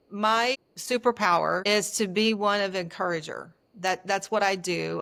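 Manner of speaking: normal talking voice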